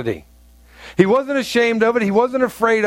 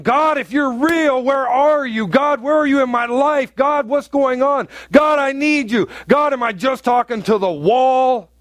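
higher, pleading cry to God; desperate plea; 6 semitones higher than usual. Retold or imitated. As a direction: call out to a God who seems absent. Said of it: "you're going".

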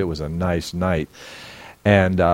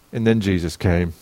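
normal talking voice

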